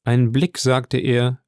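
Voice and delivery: plain reading voice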